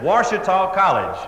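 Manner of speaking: slightly exasperated repetition; a little irritated. Retold or imitated. Caller repeating the college name to the operator, a little irritated.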